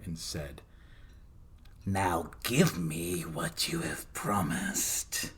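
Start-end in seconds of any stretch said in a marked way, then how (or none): 1.9-5.3 very slow, menacing imp voice; slow, creeping creature threat; little man voice. A narrator's reading. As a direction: demand it slowly and coldly like a sinister gnome. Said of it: "he stepped into her chamber".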